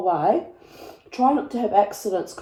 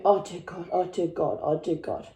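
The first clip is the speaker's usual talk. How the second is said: low, hushed repeated plea; muttered anxious prayer, getting softer; praying under breath; 3 semitones lower, 6 dB quieter than usual. Retold or imitated. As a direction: pray it quietly on a loop. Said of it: "praying the whole way".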